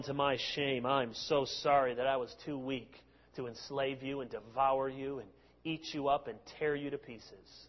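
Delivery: low, mock-apologetic sarcasm; sarcastic. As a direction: feign shame in a low voice.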